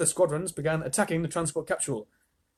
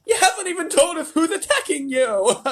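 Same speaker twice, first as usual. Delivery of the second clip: high, loud demand; yelling president; 7 dB louder than usual. A narrator's reading. The president yells at the astronauts over radio, high and loud. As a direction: bark at the astronauts. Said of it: "yelled the president".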